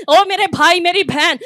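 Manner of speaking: ordinary talk, nothing marked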